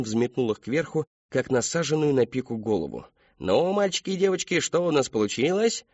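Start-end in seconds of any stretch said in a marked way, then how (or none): 3.4-5.8 high, sing-song question; lilting kids' show host; clown entertainer. A narrator's reading. Clown asks children what they made, playful showman.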